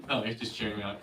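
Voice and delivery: ordinary delivery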